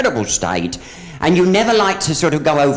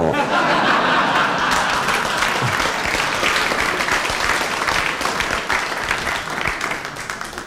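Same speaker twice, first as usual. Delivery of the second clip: laughter; 9 semitones lower, 4 dB quieter than usual. Real, not acted. Audience laughter.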